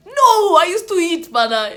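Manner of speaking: loud, high burst of protest; emphatic protest. Real, not acted. Explaining her appetite to viewers, emphatic protest. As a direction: jump in high and loud, falling at the end.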